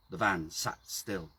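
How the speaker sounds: usual speaking voice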